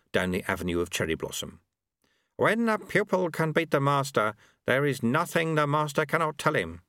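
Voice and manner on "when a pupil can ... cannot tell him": higher-pitched master reciting a rule; sweeper character, instructive. Acted. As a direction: recite it as the old sweeper to his pupil. Voices the sweeper quoting a rule.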